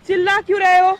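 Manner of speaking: loud, high, indignant comeback; angry retort. Real, not acted. Woman turns man's complaint back on him, angrily loud. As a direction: throw the accusation back loudly.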